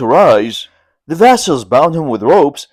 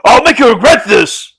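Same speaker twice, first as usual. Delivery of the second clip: angry threat; 8 semitones higher, 5 dB louder than usual. A narrator's reading. Lin threatens the vassals.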